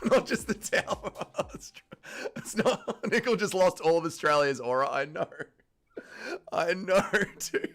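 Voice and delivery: talking through laughter; laughing. Real, not acted. Streamer laughing at Nickel losing the country's aura, laughing. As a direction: laugh helplessly between the words. Laughing over the thrown towel.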